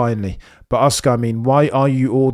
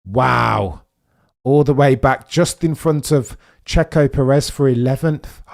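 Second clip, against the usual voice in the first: ordinary delivery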